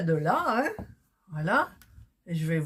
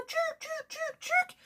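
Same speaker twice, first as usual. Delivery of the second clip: petits claquements très aigus et doux; bruit de point imité; 4 dB quieter, slower than usual. Retold or imitated. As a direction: imiter le fil qui saute d'une voix de fausset. Imite le bruit du point qui saute. (onomatopée aiguë et légère du point)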